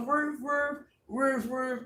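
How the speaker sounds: high doubled woofs; imitated dog barks. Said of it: "Even the dogs is out there".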